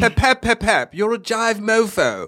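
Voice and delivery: high mock director voice. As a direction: ham up a clueless director's jive.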